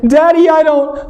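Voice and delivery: put-on high, slow, straining child; a kid about to throw up; retching child. Daughter about to vomit.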